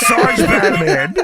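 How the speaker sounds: high, slow laughing yell; laughing shout. Real, not acted.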